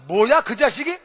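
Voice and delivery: 화가 나서 "체포해!"라고 크게 외치듯이; 버럭 고함치며 체포를 명령하는 대통령; angry order. Retold or imitated. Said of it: "대통령이 너무 기분 나빠가지고". (분노한 대통령이 부하에게 크게 외치는 명령)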